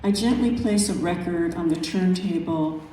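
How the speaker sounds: measured, unhurried poetry reading; calm, reflective recital of a nature poem. Acted. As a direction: let each image breathe in its pauses. Reads her second poem.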